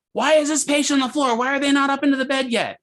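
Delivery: high-pitched, indignant mock yell; imitated yelling doctor. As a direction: play a doctor yelling at the staff. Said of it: "starts yelling at all of us".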